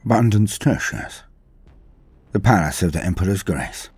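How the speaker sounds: normal talking voice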